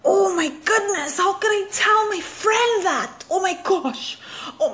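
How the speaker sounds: high, horrified self-talk; shocked at herself. Retold or imitated. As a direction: gasp at your own words, pitched high. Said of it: "I was like".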